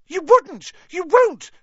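high, loud gasped cries; horrified gasp. A narrator's reading. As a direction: gasp it out in panic. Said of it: "gasped Pettigrew".

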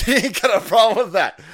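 loud mocking laugh through the words; scornful laughing jab. Real, not acted.

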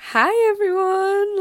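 high, loud, drawn-out hello; over-chatty greeting. Retold or imitated. Newcomer greeting the common room, awkwardly over-chatty. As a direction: overdo a chirpy hello to strangers.

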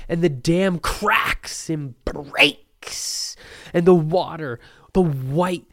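hammed-up epic crescendo; mock-epic build. Mock-epic build to show's name.

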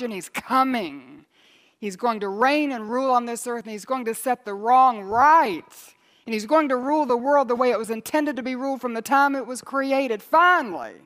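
loud, high fervent preaching; thundering revival preacher; preaching at full force. Acted. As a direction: build the congregation to a roar.

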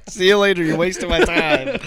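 loud, high, laughing send-off; mock dismissive shout. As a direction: holler it playfully as you send the customer packing. Acting a salesman dismissing a customer.

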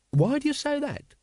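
high-pitched, startled query; sharply alert. Acted. Secretary looks up and questions the captain, sharply alert. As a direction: jump on it with a sudden high question.

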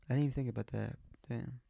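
usual speaking voice

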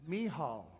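"Michal" said mee-HALL; correct pronunciation. Shown as a demonstration. Preacher showing how to say the name, "Michal" as mee-HALL. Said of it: "I found out how to say it".

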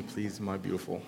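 soft, low murmur to his daughter; quiet aside. Real, not acted. Aside asking daughter for water.

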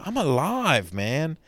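high, shouted cry of being alive; joyful yell of being alive; mock scream. Shown as a demonstration. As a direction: belt it out to the sky. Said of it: "sometimes you just gotta scream".

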